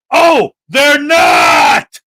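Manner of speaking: loud, drawn-out mock revelation; big exasperated holler; sarcastic shout. Real, not acted. Sarcastic answer to own challenge.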